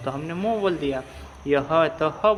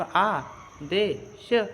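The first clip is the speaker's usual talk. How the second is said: "आ, दे, श" as separate, higher-pitched sounds; sounding out; 6 semitones higher, much slower than usual. Shown as a demonstration. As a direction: spell out "आ, दे, श" one sound at a time. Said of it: "तो हमने कहाँ पढ़े".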